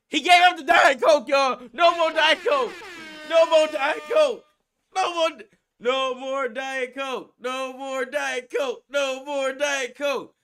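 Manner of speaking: loud, high hype chant about giving up Diet Coke; hyped shouting. Real, not acted. Friend hyping Nobi's quitting Diet Coke, loud chant. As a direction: chant it like a victory cry.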